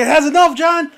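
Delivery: loud, high-pitched re-enacted comeback; exasperated retort. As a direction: snap back at John in exasperation. Retold argument with John.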